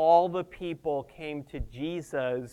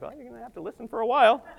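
higher, very quick comeback; self-quote retort; 5 semitones higher, much faster than usual. Retold or imitated. Preacher quoting own reply to dad, quick retort. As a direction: toss the retort back fast, pitched up. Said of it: "and I'm like".

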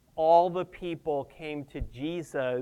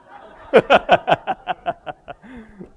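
loud, higher-pitched burst of laughter; 3 semitones higher, 8 dB louder than usual. Real, not acted. Preacher laughing at own joke, hearty laugh. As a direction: laugh out in a long run that tails off.

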